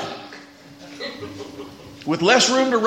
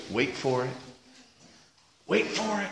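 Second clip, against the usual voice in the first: hushed, low wait for it; hushed anticipation; 11 semitones lower, 12 dB quieter than usual. Retold or imitated. Quiet coach holding back as the door closes, hushed. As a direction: hold it back quietly, expectant. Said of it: "they're quiet".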